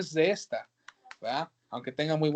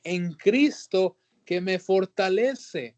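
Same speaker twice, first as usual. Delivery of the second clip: slow weighty reading; 3 semitones higher, much slower than usual. Acted. Preacher stresses the verse phrase.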